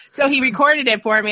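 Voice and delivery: normal talking voice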